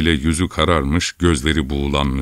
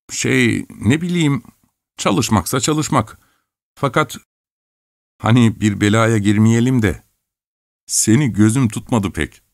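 put-on higher, unsure young voice; hesitant village lad; 6 semitones higher than usual. A narrator's reading. Lad hesitating over Chelkash's offer. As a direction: hedge nervously.

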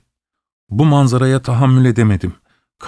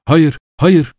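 shouting no; 4 semitones higher, 3 dB louder than usual. Acted. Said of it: "diye bağırdım".

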